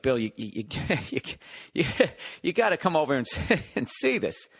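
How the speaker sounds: words broken up by giggles; mock helpless laughter, stammering to get it out; imitating laughing contractor. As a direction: act out a caller who can't stop chuckling. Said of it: "he's actually laughing".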